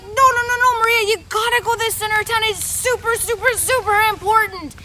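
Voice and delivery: won't take no for an answer; insistent excited kid. Acted. Kid insisting Maria must get to town. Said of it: "the kid was like".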